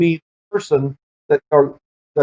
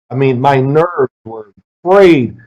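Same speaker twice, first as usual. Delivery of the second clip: usual speaking voice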